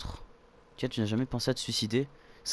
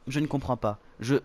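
ordinary delivery

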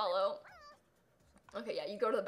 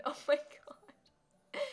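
slow whisper; 2 semitones higher, much slower than usual. Real, not acted. Whispered reaction.

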